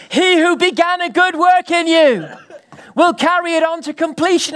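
high, loud preached scripture; fervent preaching. Acted. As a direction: proclaim it to lift the room.

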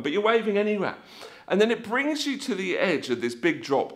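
normal talking voice

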